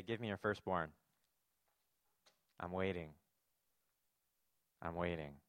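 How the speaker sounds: lightly voiced God, low-pitched and measured; a hint of a waiting deity, low with long pauses; demanding God. Imagined stern demand from God.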